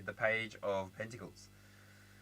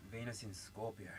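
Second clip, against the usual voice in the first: softer, half to herself; hushed repeat; 3 semitones lower, 11 dB quieter than usual. Real, not acted. Reader repeating the sign softly to herself. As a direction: murmur it again under your breath.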